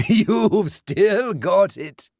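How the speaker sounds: the ghoul fawns over his own cruelty; self-admiring ghost. A narrator's reading. Dead man congratulates himself, preening.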